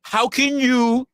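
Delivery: high, drawn-out whiny plea; mock indignant plea. Streamer mock-pleading with Resto and Kev, high indignant whine. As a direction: plead way up high, dragging the words.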